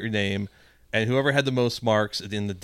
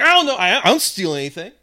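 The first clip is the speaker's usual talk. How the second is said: high, loud, defensive whine; whiny protest; 8 semitones higher, 8 dB louder than usual. Retold or imitated. Customer whining to the manager that he doesn't steal, high and loud. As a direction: protest your innocence indignantly.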